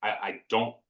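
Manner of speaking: normal talking voice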